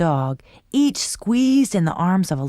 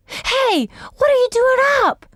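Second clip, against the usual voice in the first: high, loud and fast; surprised child; 4 dB louder than usual. A narrator's reading. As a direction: catch him sneaking out. Sister catching brother.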